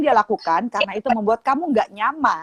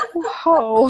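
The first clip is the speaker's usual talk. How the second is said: ordinary talk, nothing marked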